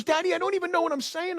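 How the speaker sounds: put-on high, fast little-girl voice; dad quoting his young daughter, high and quick; daughter's voice. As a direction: mimic a seven-year-old girl. Dad quoting his seven-year-old daughter.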